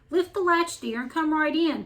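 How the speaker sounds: a mildly put-on feeble granny voice from the wolf; weak voice. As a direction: fake being frail and sickly. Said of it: "called the wolf in a weak voice".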